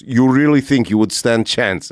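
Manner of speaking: imitated Russian tough guy sizing someone up; challenging Russian accent. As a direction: taunt in a put-on Russian accent. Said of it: "He goes".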